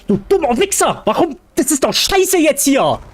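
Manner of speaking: high, mock-angry German insult. Shown as a demonstration. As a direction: spit a playful German insult, pitched high. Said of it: "I would just, uh, insult you in German".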